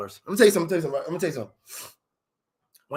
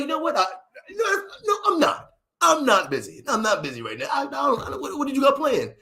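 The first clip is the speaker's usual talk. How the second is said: high-pitched mock flustered eagerness; flattered man; 7 semitones higher than usual. Flattered man telling stranger he is not busy, high flustered eagerness. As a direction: stammer upward, playing flattered and available. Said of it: "You start feeling special".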